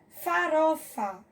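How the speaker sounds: "Farofa" slowly split as fa, ro, fa; syllable by syllable. Shown as a demonstration. Teacher counting a word's syllables, syllable by syllable. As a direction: say "Farofa" in three slow syllables. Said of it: "separar as sílabas".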